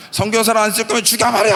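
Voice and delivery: high, loud, desperate cry at God; anguished demand, high and loud; tearful shouting prayer. Retold or imitated. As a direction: yell it through tears, pleading. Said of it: "하면서 막 울고".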